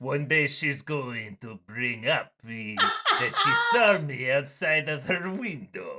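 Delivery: slow, hammed-up Russian or French accent; vague Russian-French accent. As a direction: do a smoking monkey with a muddled Russian-French accent. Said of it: "accent. I don't know what accent that is. It's a little Russian".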